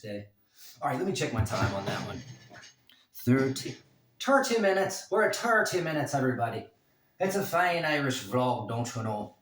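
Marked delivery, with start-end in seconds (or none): 4.2-5.1 put-on loud, high Irish accent, THIRTY said like "tarty". Vlogger playfully checking his time, in an Irish voice. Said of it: "I love doing the Irish voice". 5.1-9.4 Irish voice. Acted. Vlogger announcing his Irish vlog, in a put-on Irish voice. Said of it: "I love doing the Irish voice".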